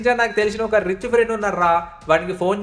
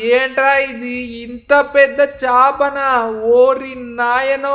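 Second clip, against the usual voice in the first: drawn-out amazed cry, higher; slow astonished gasp; 4 semitones higher, 4 dB louder, slower than usual. Acted. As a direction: stretch every word in shock at the giant fish. Uncle sees a huge fish.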